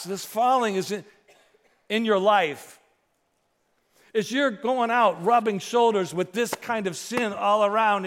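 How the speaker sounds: loud, high, ringing pulpit voice; fiery sermon climax; full-force preaching. Acted. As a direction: preach it out to the whole room.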